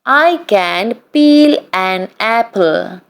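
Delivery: ordinary talk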